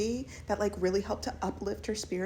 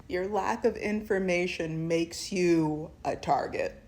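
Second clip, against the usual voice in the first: ordinary talk, nothing marked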